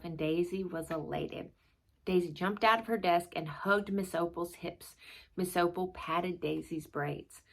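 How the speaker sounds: steady read-aloud storytelling voice; story narration. A narrator's reading. Narrator reading a chapter book to viewers, storytelling. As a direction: tell the class story to young listeners.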